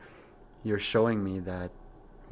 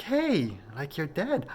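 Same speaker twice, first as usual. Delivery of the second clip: much higher, louder startled retelling; shocked realization; 7 semitones higher, 3 dB louder, slower than usual. Retold or imitated. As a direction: blurt it out as the shock hits. Sees dead aunt in dream.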